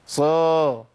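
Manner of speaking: صا loud and heavy with ص; صا said emphatic instead of light س; wrong emphatic sad. Shown as a demonstration. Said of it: "ما اقولش صر". (صا بتفخيم خاطئ)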